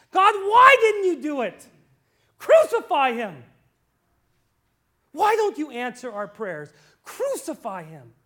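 high, loud furious yelling at God; angry crowd shout. Retold or imitated. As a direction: hurl the words in rage.

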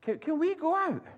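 put-on high little-boy voice, begging; pleading child. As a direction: ask like an eager young prince. Young princes begging to go out.

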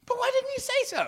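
high, squeaky mock astonishment; baffled squeak. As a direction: squeak it out in disbelief. Mimicking a baffled reply.

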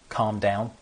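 usual speaking voice

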